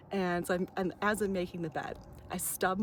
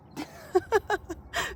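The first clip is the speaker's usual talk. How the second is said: sudden high yelp of a laugh at a bee; startled laugh; 14 semitones higher, 3 dB louder than usual. Real, not acted. Startled by a bee buzzing close, she lets out a sudden high laugh. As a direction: jump and laugh out loud.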